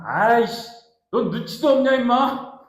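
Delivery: irritated, high and loud acted scolding; angry telling-off. Annoyed Jesus telling off the official, irritably. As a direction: snap at the tactless man. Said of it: "막 이렇게 막 화".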